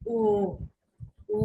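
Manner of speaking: "ও" with tightly rounded lips; rounded-lip pressed vowel. Shown as a demonstration. Teacher demonstrating o-umlaut, lips rounded with slight pressure. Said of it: "ঠোঁট গোল করে একটু pressure দিয়ে".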